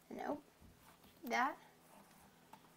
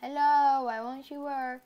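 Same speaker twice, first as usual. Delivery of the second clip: pleading with a machine; 3 semitones higher, 11 dB louder than usual. Real, not acted. Game piece won't place.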